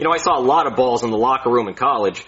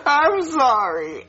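sobbing apology; 10 semitones higher, much slower than usual. Acted.

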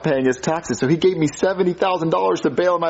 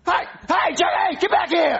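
shouting after someone; 14 semitones higher than usual. Acted. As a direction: yell it down the street. Yelling after the fleeing kid.

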